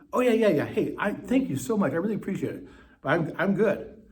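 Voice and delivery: high, loud, eager reassurance; acted effusive thanks. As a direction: brush off the concern with bubbly gratitude. Acted cheerful thanks to well-wisher.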